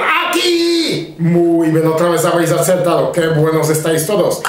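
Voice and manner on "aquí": mock child's call, much higher; eager pupil's call, higher; kids shouting. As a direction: pipe up like an eager kid. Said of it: "Y los niños gritan".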